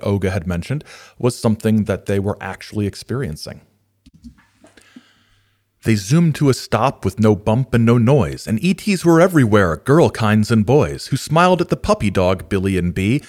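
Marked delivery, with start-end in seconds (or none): 5.8-13.2 croaking toad. Acted. Reads rhyming picture book aloud.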